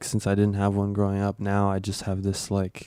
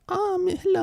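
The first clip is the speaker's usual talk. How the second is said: high, silly voice for a dog. Acted. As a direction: coo at the dog in a goofy old-man voice. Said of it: "like, the voice of".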